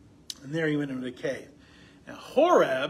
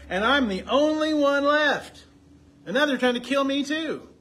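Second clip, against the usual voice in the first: higher and louder, a weary prophet pleading his case; preacher reading Elijah's exhausted complaint to God, higher and louder; worn-out Elijah; 7 semitones higher, 8 dB louder than usual. Acted. Elijah telling God he alone is left, pleading higher and louder. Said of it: "he's worn out".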